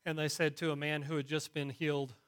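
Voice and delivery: ordinary talk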